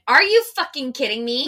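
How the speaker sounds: loud, high snap of disbelief; angry customer. Retold or imitated. Customer snapping at the barista in the drive-thru, loud and outraged. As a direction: hit her indignant outrage. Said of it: "She went".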